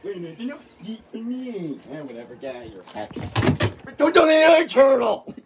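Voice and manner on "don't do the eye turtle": shouting. Real, not acted. Kids playing, yelling a warning.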